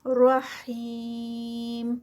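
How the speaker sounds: رحیم with the long i held for four counts; four-count stretch. Shown as a demonstration. Teacher showing a four-count stretch on رحیم. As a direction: draw out رحیم slowly, four beats on the i. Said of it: "four counts کا stretch".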